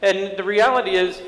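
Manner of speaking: high, insistent sermon voice; impassioned preaching. Acted. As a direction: press the point home to the congregation. Preacher builds to the sermon's point.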